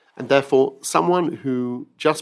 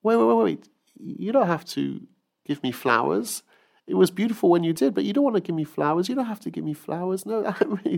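gentle mother; 7 semitones higher than usual. Acted. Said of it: "And his mother says".

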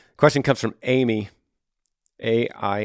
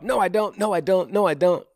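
heart screams out; 7 semitones higher than usual. Pretend. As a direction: let the heart cry out against it, pitched way up. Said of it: "your heart screams out".

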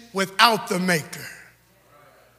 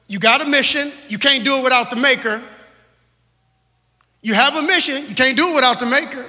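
preaching; 3 semitones higher, 5 dB louder, faster than usual. Real, not acted. Preacher driving home the mission to the congregation. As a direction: hammer it twice to the church.

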